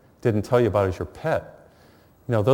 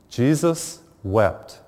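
slow, weighty reading of the verse; solemn scripture reading; much slower than usual. Acted. Priest quoting scripture to grieving listeners, slow and solemn. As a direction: let each word land slowly.

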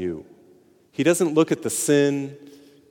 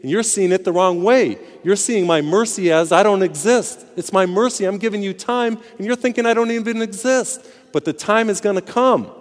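pleading, raised and louder; voicing God; 7 semitones higher, 4 dB louder than usual. God warning sinners who mistake his mercy, raised and pleading. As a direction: press the point like a patient but warning God. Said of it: "God is saying".

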